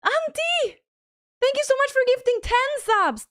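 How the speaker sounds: very high, loud cry of thanks; thrilled surprise. Real, not acted. Streamer thanking a viewer for ten gifted subs, thrilled. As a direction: squeal the name, then gush thanks loudly.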